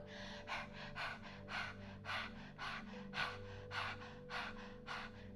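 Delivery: rapid breathy dog panting, tongue out. Shown as a demonstration. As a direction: pant fast like a dog. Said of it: "panting like a dog".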